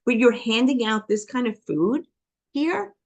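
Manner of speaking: incredulous jab. Retold or imitated. Patient confronting doctor over junk food, incredulous. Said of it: "I go".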